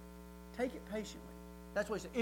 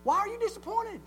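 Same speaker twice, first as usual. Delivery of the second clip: high-pitched incredulous coach; mock coach berating, pitched way up; exasperated coach; 11 semitones higher than usual. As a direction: scold a player in disbelief. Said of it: "the coach goes".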